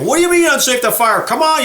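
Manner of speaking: upset outburst. Retold or imitated. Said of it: "he got all upset".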